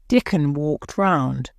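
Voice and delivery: plain reading voice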